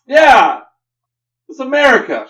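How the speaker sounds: high, very loud whoop; mock patriotic yell. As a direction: shout it like a rowdy heckler. Joins in as the yelling heckler.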